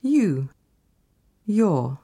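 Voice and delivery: ordinary talk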